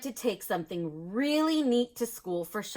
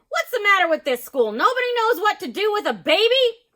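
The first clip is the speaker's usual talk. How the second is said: put-on exasperated boy; 11 semitones higher, 8 dB louder than usual. A narrator's reading. Ben exasperated.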